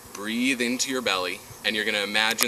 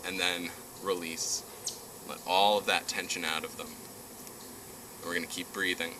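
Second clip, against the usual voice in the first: guided meditation; 7 dB quieter than usual. Acted. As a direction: ease them down into calm.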